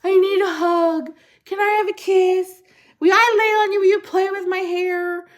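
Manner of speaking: high, loud, needy pleading; mock-needy high-pitched begging; clingy family imitation. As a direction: mimic loved ones pestering for affection. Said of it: "'cause they're constantly like".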